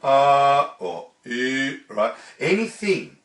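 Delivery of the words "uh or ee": "uh, or, ee" held with the cheek muscles working; wrong facial tension. Shown as a demonstration. Teacher showing incorrect diction, tense held "uh, or, ee". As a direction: hold "uh, or, ee" with a tensed upper face. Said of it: "working in this area ... is incorrect".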